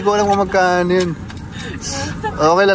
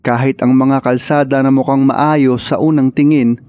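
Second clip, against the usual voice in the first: loud storytelling narration; voiceover narration; 4 dB louder than usual. Acted. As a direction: narrate the trip like a documentary. Vlog narration, louder.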